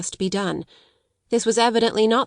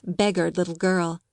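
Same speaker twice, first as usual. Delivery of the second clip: usual speaking voice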